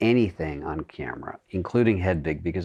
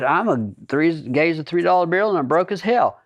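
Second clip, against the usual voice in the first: Oklahoma twang; 8 semitones higher than usual. Retold or imitated. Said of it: "And there's".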